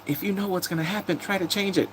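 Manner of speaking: high, soft imitation of someone pleading; retold urging. Streamer voices a character urging him to change fate, high soft plea.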